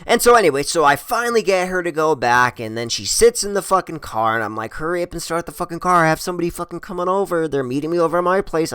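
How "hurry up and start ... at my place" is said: rapid-fire retold nagging; fast, pressing plea; impatient hurrying. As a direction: rush the words to get someone moving. Urges her to start the car.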